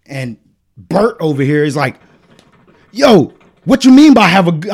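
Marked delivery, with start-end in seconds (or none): 2.9-4.7 louder, higher confrontational challenge; acting tough. Imitating Bert challenging his goodbye, acting tough. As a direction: mimic a guy squaring up, loudly.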